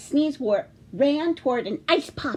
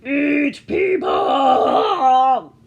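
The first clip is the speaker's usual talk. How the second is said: loud, very slow monster voice; monster shout; 9 dB louder, much slower than usual. Acted. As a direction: drag it out like a hungry beast. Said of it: "said the sea monster".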